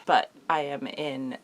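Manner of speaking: ordinary talk, nothing marked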